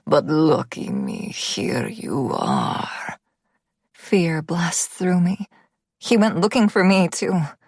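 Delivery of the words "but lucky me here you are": put-on slow, deep Russian-accented menace. A narrator's reading. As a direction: drag it out low in a heavy Russian accent. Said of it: "deep and thick with a heavy Russian accent".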